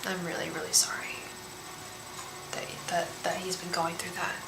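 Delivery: whispered, breaking sympathy; barely voiced, moved to tears; a reader whispering condolences, overcome; emotional whisper. Real, not acted. Emotional reader consoling client.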